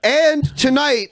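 high, slow, punched-out words; forceful drawn-out show-host delivery; aggressive announcement. Real, not acted. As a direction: belt it out emphatically. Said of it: "You sound very aggressive".

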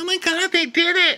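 high, loud hammed-up squeal; mock excited squeal. Housemates cheering a kiss, high mock squeal. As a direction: squeal like thrilled onlookers. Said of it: "everyone's like".

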